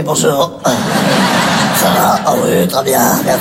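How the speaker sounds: voix grave et enrouée du réveil, bien plus basse; voix du matin. Retold or imitated. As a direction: bonjour pâteux de quelqu'un qui sort du lit. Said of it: "c'était ma voix". (voix rauque du matin, très basse)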